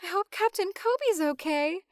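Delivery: high, lightly acted lady; lady captive. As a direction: put on a high voice for a concerned lady. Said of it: "and the lady says".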